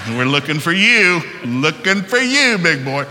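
loud, rising challenge to the devil; mock taunting.

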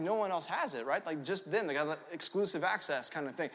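usual speaking voice

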